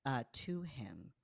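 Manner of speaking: normal talking voice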